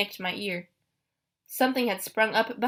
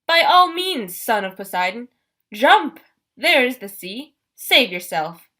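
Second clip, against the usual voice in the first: menacing monster taunt; 3 semitones higher, 8 dB louder, slower than usual. Acted. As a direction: taunt the boy to jump, gloating. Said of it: "Doctor Thorn laughed".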